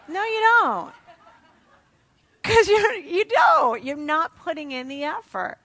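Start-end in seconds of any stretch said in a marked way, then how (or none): 0.1-3.8 emphatic retort. Real, not acted. Repeated retort to the audience.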